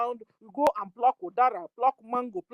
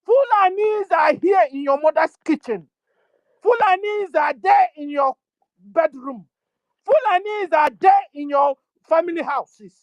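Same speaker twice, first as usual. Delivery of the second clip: raised alarm shout at the listeners; shouted warning; 2 semitones higher, 4 dB louder than usual. Real, not acted. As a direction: hammer the warning home at full voice.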